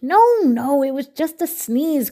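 cried out. A narrator's reading. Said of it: "cried Hippo".

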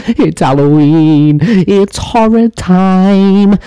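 long-drawn, high spooky wail; spooky announcement. Pretend. Host opening the Halloween segment for viewers, spooky wail. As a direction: stretch each phrase out high and slow.

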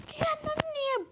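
squeak of outrage. Real, not acted. Squeaky outburst.

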